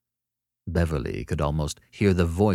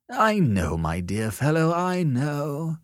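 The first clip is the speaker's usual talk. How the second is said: high, drawn-out, lordly patronage; gracious condescension; 10 semitones higher, slower than usual. A narrator's reading. Sellers reassures the beginner whose work he criticized, graciously. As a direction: play the great man graciously patronizing a beginner. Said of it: "said Sellers graciously".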